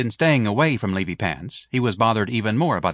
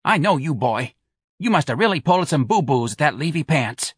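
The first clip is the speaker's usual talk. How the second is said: higher, louder New Orleans mother, nagging; a mother needling her grown son; Mrs Reilly's voice; 6 semitones higher, 3 dB louder than usual. Acted. Mother scolding son Ignatius, a nagging higher voice.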